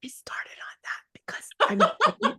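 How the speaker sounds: whispered through a giggle, high and breathless; laughing whisper. Real, not acted. Giggling in a whisper while telling the host. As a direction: laugh under your breath as you speak to the host.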